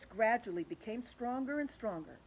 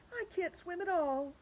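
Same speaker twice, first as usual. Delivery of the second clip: worried cry; 11 semitones higher than usual. Acted. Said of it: "cried Ladybug".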